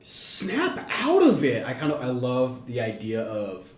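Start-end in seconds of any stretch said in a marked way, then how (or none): 0.0-1.7 high, slow mock scolding; Leia's ghost telling Han off. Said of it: "she just, like".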